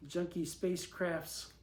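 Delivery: plain reading voice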